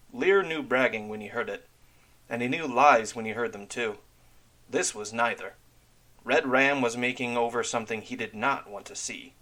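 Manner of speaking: ordinary delivery, nothing marked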